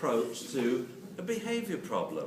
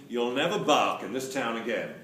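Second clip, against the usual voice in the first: mock stern threat; 7 dB louder than usual. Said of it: "So shush doesn't mean".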